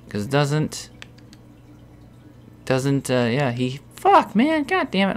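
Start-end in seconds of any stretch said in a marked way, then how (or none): 3.9-5.2 real exasperated curse. Real, not acted. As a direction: voice jumps much higher on god damn it. Frustrated gamer.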